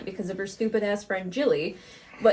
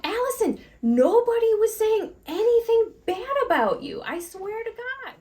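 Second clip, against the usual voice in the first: much higher, exasperated plea; pleading protest; 13 semitones higher than usual. Retold or imitated. Boss pleading with the screaming coworker, high exasperated plea. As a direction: beg her to believe you, voice up high. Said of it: "I'm like".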